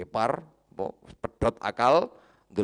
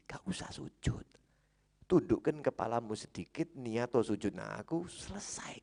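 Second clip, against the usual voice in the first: high-pitched persuasive tempter; acted devil's insistent wheedling, pitched high; coaxing devil; 8 semitones higher than usual. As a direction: coax the victim with an easy way out. Devil coaxes Barsiso to bow.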